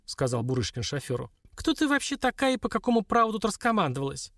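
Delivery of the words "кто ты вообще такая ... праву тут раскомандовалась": high-pitched spiteful challenge; poisonous, indignant question held up high; venomous. A narrator's reading. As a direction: put the bossy rival in her place, acidly. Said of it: "ядовито спросила Лилька".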